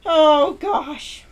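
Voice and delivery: ordinary talk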